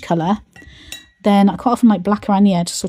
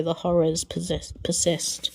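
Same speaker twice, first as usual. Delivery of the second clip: weary quote; 6 dB quieter, slower than usual. Retold or imitated. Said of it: "and you go".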